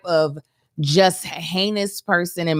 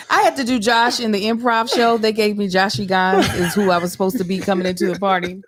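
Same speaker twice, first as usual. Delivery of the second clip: usual speaking voice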